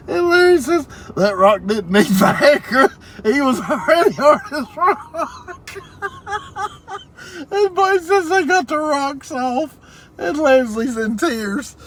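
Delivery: reading through a fit of loud, high laughter; can barely get the words out for laughing; cracking up while reading the chat aloud; laughing in tears. Real, not acted. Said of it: "I'm in tears now, too".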